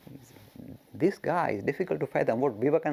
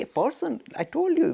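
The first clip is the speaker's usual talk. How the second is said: normal talking voice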